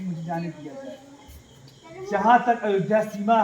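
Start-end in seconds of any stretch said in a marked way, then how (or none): 0.0-1.0 humble plea. Acted. Ram humbly pleads with townspeople.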